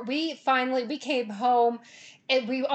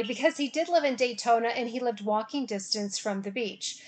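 normal talking voice

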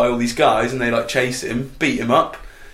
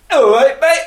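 high, louder, laughing put-on voice; flirty mate greeting; 8 dB louder than usual. Retelling the video, the lad greets the swimmer, high and laughing. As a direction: squeak a cheeky hello through a laugh. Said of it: "he sort of goes".

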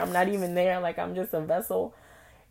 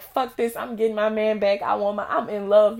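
louder, higher, determined declaration; defiant resolve; 5 semitones higher, 6 dB louder than usual. Retold or imitated. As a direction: vow it fiercely. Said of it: "So I'm like".

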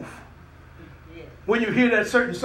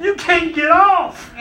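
high shouted call, cracking into laughter; laughing shout; 8 semitones higher, 4 dB louder, slower than usual. Retold or imitated. Preacher calling to a scared old lady, laughing shout. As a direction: call after her while laughing. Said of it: "I said".

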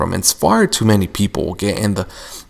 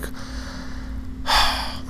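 a drawn-out fed-up breath out; voiceless, weary sigh; long exasperated sigh; 10 dB quieter than usual. Retold or imitated. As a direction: sigh like you've had enough. Exasperated at dad in his head.

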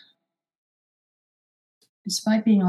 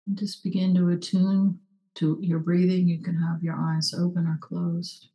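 guided meditation; 2 semitones lower, 3 dB quieter than usual. Acted. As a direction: hush down and guide them inward. Leads a short meditation.

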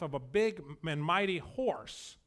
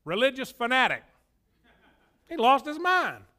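neighbors sneering; 5 semitones higher, 5 dB louder than usual. Retold or imitated. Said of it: "You know what your neighbors say?".

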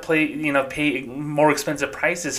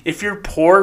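ordinary talk

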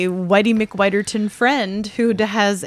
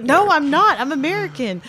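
indignant protest; 7 semitones higher than usual. Retold or imitated. Retold protest at being questioned.

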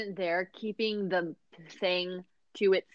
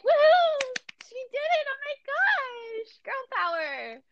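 high mock cheer, squealing the words out; hammed-up squeal of a cheering crowd; slower than usual. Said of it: "the part where they're like".

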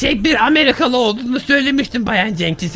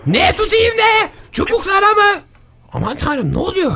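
confused alarm; 6 semitones higher than usual. Acted. Confused panic on phone.

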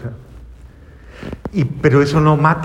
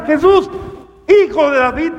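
high, loud imploring call; hopeful crying out; 11 semitones higher, 3 dB louder than usual. Acted. Blind man calls Jesus with kindled hope, crying out. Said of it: "se enciende en esperanza".

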